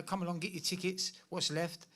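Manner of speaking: usual speaking voice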